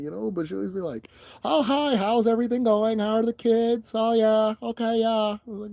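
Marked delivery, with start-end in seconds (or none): 1.4-5.4 bright, much higher, fake-polite greeting; imitating the neighbor's chirpy fake-polite hello. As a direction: sing it like a customer service rep. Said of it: "talk to me in her H R voice".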